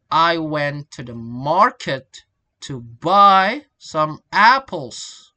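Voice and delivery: slow and loud on "market", "buy", "apples"; strong syllables stressed. Shown as a demonstration. Teacher demonstrating sentence rhythm, "market" and "buy" loudly stressed. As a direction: punch and stretch MAR and BUY, word by word. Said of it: "are stress and strong syllable".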